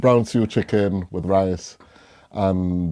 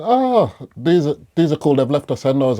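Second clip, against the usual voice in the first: excited; 6 semitones higher, 4 dB louder than usual. Retold or imitated. Retold finding relish bottles.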